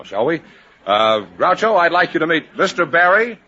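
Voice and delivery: ordinary delivery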